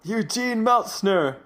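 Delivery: slow, high mock solemn intoning; spooky reading. Friend retelling the tombstone scene, hammed-up solemn. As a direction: read it like a grave inscription.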